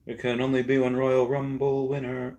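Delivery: hammed-up Royal Rumble proclamation; mock proclamation. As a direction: proclaim it in a silly high voice. Mock proclamation, then suggests it for shout-outs.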